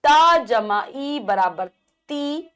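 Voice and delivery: very slow, each part held apart; slow spelling out. Shown as a demonstration. Teacher slowly spelling a syllable for children. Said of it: "इस प्रकार से होती है".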